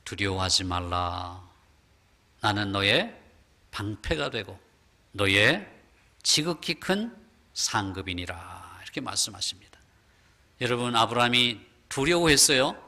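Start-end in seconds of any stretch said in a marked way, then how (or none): 0.0-8.8 lower, slow solemn divine voice; voice of God. Acted. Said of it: "하나님께서 아브라함에게 이렇게 말씀해 주십니다". 8.8-9.8 hushed whispered close. Real, not acted. Preacher trails into whisper.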